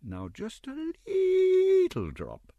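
very high, slow, wheedling leetle; persuasively. A narrator's reading. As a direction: coax him with a drawn-out sugary leetle. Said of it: "said Missus Mann persuasively".

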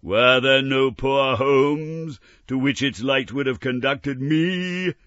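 slow building ghostly sorrow; suffering ghost. Acted. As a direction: grieve aloud as a spirit. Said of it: "the spectre said".

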